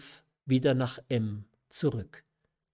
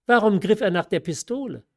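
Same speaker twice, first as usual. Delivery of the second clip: higher, louder and fast, cutting in; relentless; 7 semitones higher, 7 dB louder, faster than usual. A narrator's reading. Mother cuts her daughter off, relentless, higher and loud. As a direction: cut her off, unbending. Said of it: "Unerbittlich fiel ihr die Obristin ins Wort".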